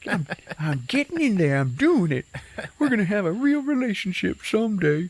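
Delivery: high-pitched, hammed-up elation; giddy triumph. Boyfriend alone, thinking he's getting in, hammed-up giddy triumph. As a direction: celebrate to yourself, pitch climbing high.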